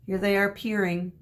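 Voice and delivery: ordinary delivery, nothing marked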